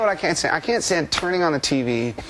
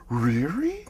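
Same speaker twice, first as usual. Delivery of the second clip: imitating the interviewer; 4 semitones higher than usual. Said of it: "she'd go".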